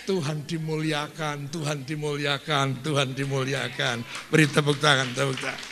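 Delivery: loud call to praise God; rally the church to clap; preaching praise. Real, not acted. Preacher leading the congregation, loud.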